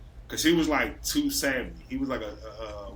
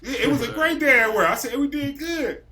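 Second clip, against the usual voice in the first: higher, louder, proud and pleased; proud excitement; 9 semitones higher, 6 dB louder than usual. Real, not acted. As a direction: crow about a great day. Boasting about the high day.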